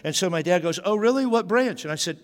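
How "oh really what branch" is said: high, eager dad imitation; dad's voice. Son imitates dad perking up at enlisting, high and eager. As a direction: pitch up like a keen dad.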